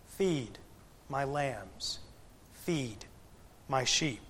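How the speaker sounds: soft, slow and hushed, with pauses between each command; gentle quiet command. Acted. Preacher voicing Jesus tasking Peter, hushed and slow. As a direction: let Jesus speak tenderly and quietly.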